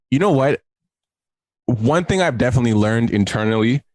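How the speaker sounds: normal talking voice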